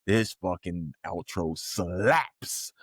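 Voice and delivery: drawn out, slowed right down; slow savoring. Retold or imitated. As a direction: savor each word of praise. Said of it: "But you're like".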